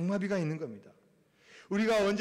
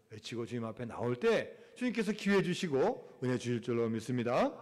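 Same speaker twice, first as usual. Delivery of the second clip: ordinary delivery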